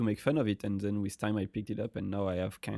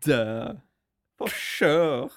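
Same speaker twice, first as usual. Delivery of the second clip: louder, higher, botched Valley Girl attempt in a French accent; failed Valley Girl; 4 semitones higher, 6 dB louder, much slower than usual. Shown as a demonstration. French co-host copying the host, badly done Valley Girl. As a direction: try a Valley Girl and miss. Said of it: "You can't do it".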